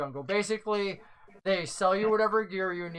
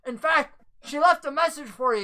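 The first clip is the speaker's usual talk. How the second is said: NPC voice; 7 semitones higher, 4 dB louder than usual. Acted. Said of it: "He goes".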